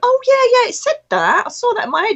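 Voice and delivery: high-pitched excited recall; sudden recognition. Retold or imitated. Telling a friend what was seen, excited recognition. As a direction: blurt it high, as it comes back.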